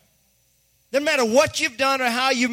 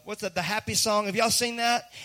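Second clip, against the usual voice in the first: usual speaking voice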